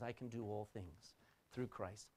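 soft quoted verse. Shown as a demonstration. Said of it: "what Paul was saying".

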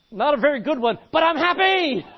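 loud, high comic retort; hammed-up happy yell; defiant cheerful shout. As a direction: belt it back at your mocker. Acted reply to a mocker.

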